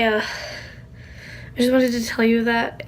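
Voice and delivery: usual speaking voice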